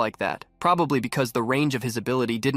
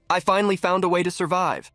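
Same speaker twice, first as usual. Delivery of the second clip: excited; 6 semitones higher than usual. Acted. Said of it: "Laughing excitedly".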